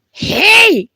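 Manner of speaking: very high, loud "Hey!"; sharp shout. Acted. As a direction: snap at the interrupter. Interrupted storyteller snaps.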